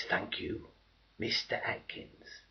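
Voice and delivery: hushed, breathy whisper, slowed; soft sarcastic whisper; whispered thanks. Acted. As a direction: whisper it like a grudging thank-you. Mock-grateful civilian quote in poem.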